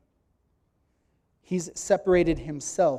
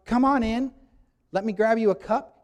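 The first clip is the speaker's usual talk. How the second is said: welcoming host; 5 semitones higher, 5 dB louder than usual. Acted. Older son greeting guests as host.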